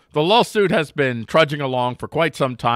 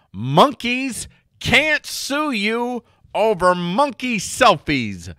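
headline announcer; 11 semitones higher, 4 dB louder, slower than usual. Acted. Headline read announcer style.

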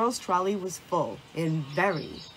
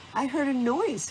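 higher, lightly hooted remark; hooting owl; 6 semitones higher than usual. A narrator's reading. Owl comes out of rustling leaves to Squirrel, lightly hooting. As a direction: hoot it gently like a concerned owl. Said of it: "hooted Owl".

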